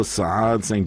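usual speaking voice

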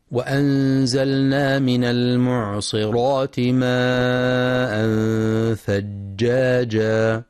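slow, measured tajweed chant; Quran recitation. Acted. Teacher reciting the verse to be studied, slow tajweed recitation. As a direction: recite slowly with drawn-out long vowels.

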